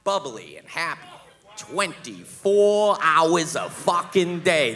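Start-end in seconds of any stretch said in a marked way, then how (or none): 1.5-4.7 slow, loud exasperated rant; comic fed-up complaint, louder. Acted. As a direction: drag out every word in disbelief. Exasperated rant about fiancee.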